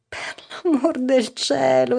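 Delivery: a mother close to tears; a touch of tearful alarm. A narrator's reading. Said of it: "esclamò la madre già in lacrime".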